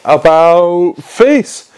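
drawn-out parade-ground order; drill command. A narrator's reading. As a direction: stretch the command out, rising in pitch. Captain barks a drill order.

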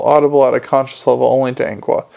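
ordinary delivery

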